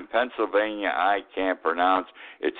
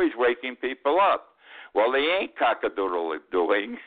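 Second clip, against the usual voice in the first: ordinary talk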